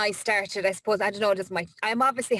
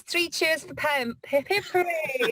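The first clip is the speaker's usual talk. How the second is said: high, slow hammed-up cheering; mock cheer; 7 semitones higher, slower than usual. Host reads a listener's cheer aloud to the cafe, high and slow mock cheer. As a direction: lead the room in three cheers, drawn out.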